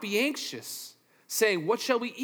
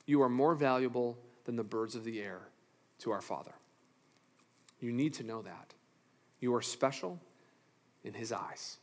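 much softer, lower and intimate; tender reassurance; 8 semitones lower, 9 dB quieter than usual. Real, not acted. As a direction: lean in and comfort them quietly.

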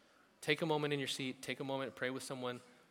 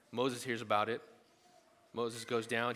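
normal talking voice

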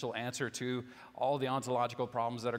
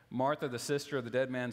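ordinary talk

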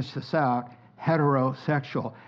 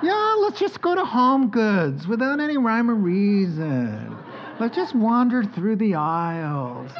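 mock wife, laughing; 6 semitones higher than usual. Voicing wife's plan.

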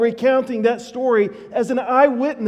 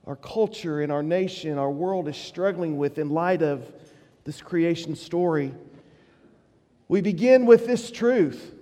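usual speaking voice

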